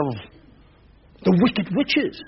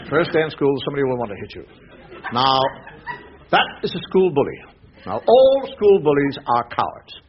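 father's advice; 8 semitones lower than usual. Retold or imitated. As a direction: lay it down like a wise old father. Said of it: "My father said to me".

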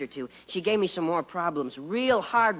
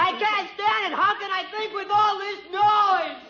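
put-on boy blowing up at the noise; frustrated outburst. Son explodes at the noise.

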